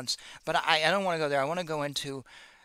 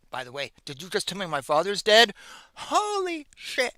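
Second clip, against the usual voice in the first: retold shock; 5 semitones higher, 4 dB louder than usual. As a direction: imitate stunned disbelief, stretching the last words high. Retold shocked reaction to father's death.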